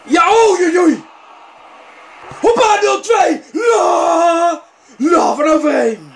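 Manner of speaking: high, loud ecstatic shouting; goal celebration. Real, not acted. Gamer celebrates scoring nil-two, high excited shout. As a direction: explode with joy at the goal.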